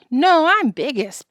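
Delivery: put-on squabbling boy staking his claim; boastful protest. A narrator's reading. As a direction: push in with a drawn-out objection. Boys squabble over first ride.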